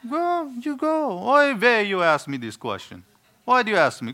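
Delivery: put-on high, sarcastic Yiddish accent.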